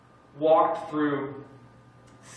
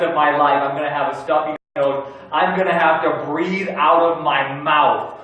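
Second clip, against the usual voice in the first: louder, slow hammed-up dread; a stuffed-up patient dramatizing endless doom; mock panic; 3 semitones higher, 7 dB louder than usual. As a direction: play the sick person spiraling into panic, slow and louder. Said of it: "we start to panic".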